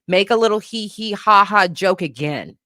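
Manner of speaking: usual speaking voice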